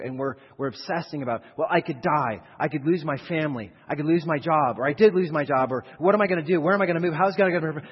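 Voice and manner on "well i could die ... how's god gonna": louder, rising panicked worry; anxious fretting. Pretend. Preacher voicing a worried believer, anxious and loud. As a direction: pour out the fears faster and faster.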